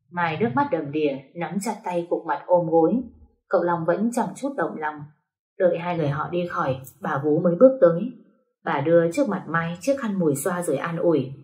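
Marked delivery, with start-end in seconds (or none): none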